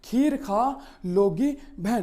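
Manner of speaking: slowly, word by word; slow, deliberate classroom recital; slow mnemonic sentence. Shown as a demonstration. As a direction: spell out the memory line slowly so it sticks. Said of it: "वो कह रहा है".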